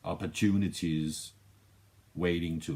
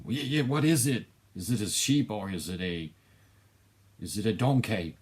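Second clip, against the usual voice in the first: higher, louder and fast, baffled squint of a voice; hammed-up bewildered question, louder and quicker; mock confused viewer; 5 semitones higher, 4 dB louder than usual. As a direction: play a puzzled fan who can't tell what it is.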